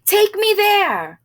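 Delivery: high, slow urgent call; calling to a three-wheeler driver. A narrator's reading. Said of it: "called out".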